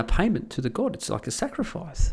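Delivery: usual speaking voice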